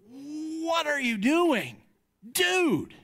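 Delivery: high, slow, incredulous mock dismay; exasperated dad. Dad retelling how he scolds his screaming son, mock exasperated. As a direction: play up a dad's baffled frustration. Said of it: "and I'm like".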